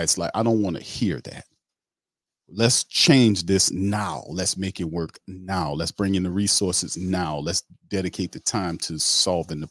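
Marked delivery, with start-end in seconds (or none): 2.5-7.4 slow, punched "now" at each line end; stress on NOW. Real, not acted. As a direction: hammer each "now" slowly. Worked-up demand for change.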